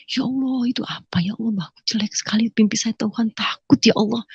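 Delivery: trembling soft plea to God; frightened prayer. Retold or imitated. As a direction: pray quietly, shaken after a nightmare. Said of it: "Saya ketakutan".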